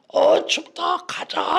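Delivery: high, loud mimicked child; child shouting. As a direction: yell like a kid demanding to leave. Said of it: "그러는 거예요". (아이 흉내로 높고 크게 외치며)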